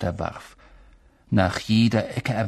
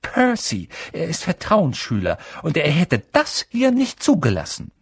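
put-on higher, prim lecturing girl; prim schoolgirl; 6 semitones higher than usual. A narrator's reading. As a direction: lecture them like a prefect. Hermine keeps lecturing.

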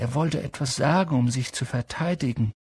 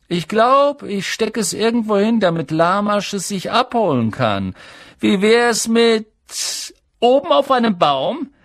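taunting; 9 semitones higher, 6 dB louder than usual. A narrator's reading. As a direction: taunt him from on high, stretching the ends. Malfoy taunts Harry in story. (spöttisch, laut und hoch)

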